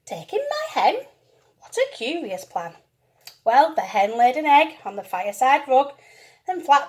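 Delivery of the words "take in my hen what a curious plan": surprised. Acted. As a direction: squeak it out in disbelief. Lady baffled by odd advice.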